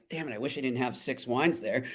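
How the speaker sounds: quiet, laughing through the words; soft self-mocking laugh while talking; laughing regret. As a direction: chuckle at your own tipsy self. Retelling his tipsy regret.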